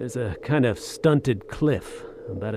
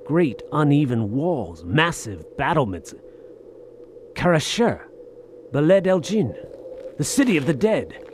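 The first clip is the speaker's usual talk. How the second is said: awed excitement; 5 semitones higher than usual. Acted. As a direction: call out the ancient names in growing awe. Seeing the lost city appear.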